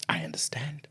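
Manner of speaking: hushed, voiceless whisper. Real, not acted. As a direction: say it under your breath in a whisper. Whispered, then repeated aloud.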